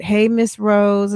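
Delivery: ordinary talk, nothing marked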